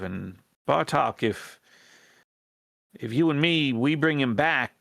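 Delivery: lightly voiced rat plotter in folksy dialect; folksy character voice, halting appeal; earnest rebel rat leader. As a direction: hesitantly plead for the tribe's support.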